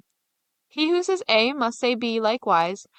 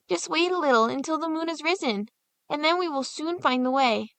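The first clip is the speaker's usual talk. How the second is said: put-on higher boyish voice, gentle; comforting; 7 semitones higher than usual. Hansel comforting Gretel, gentle boyish voice. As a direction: reassure your little sister. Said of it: "Hansel comforted her".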